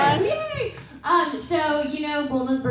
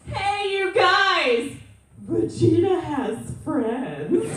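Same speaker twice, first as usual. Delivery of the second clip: put-on singsong, higher, slow drunk announcement; tipsy Bulma; 6 semitones higher, 4 dB louder, much slower than usual. Actress quotes tipsy Bulma announcing to the party, singsong. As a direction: call out to the party, a little drunk. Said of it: "she goes".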